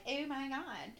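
put-on valley girl. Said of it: "really what I sound like".

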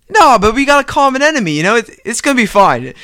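quick, upbeat reassurance in a retold quote; mildly eager pleading. Wrestler talking his reluctant partner round, mildly eager. Acts Drake's eager reply.

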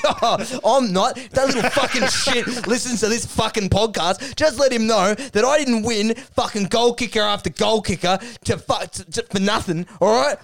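high, agitated mock tirade; hammed-up rant. Carey threatening revenge over a Brownlow, hammed-up rage.

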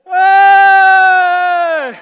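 long, very loud held shout; big shout. Real, not acted. Celebrating the finished run with one huge shout. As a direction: roar it out in triumph.

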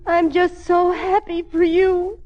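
high, slow tearful wail; crying. Acted. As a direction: weep through the words, drawing them out. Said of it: "then why are you crying".

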